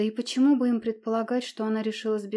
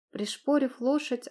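ordinary delivery, nothing marked

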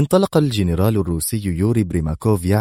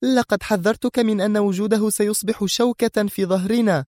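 high, reproachful exclamation; Sonia exclaiming; 13 semitones higher than usual. Acted. Sonia reproaches Mr X with a high exclamation. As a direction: remind him you warned him. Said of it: "هتفت".

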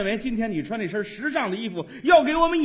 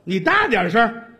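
raised, insistent comic heckle; loud demand; 3 semitones higher, 5 dB louder than usual. Acted. Straight man urging the audience to shout the answer, loud demand. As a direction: call out loudly to the crowd.

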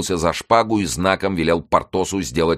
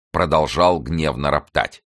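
ordinary delivery

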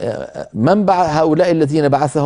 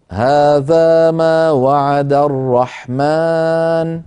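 slow chanted recitation; Quran recitation; much slower than usual. Shown as a demonstration. Teacher resumes the verse after the stop, in slow chanted recitation. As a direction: chant the verse slowly and evenly. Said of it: "فيسكت قليلًا هكذا".

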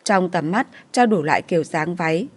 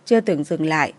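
ordinary delivery

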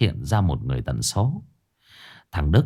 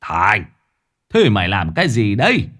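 calling down; 3 dB louder than usual. A narrator's reading. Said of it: "gọi với xuống".

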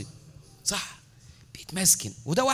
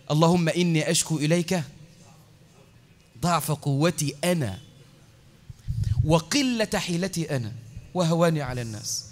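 humble supplication; 4 semitones lower than usual. Retold or imitated. Quoting the Prophet's humble supplication.

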